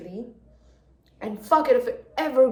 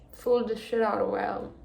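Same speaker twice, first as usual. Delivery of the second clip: ordinary talk, nothing marked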